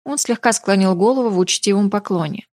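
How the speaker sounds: plain reading voice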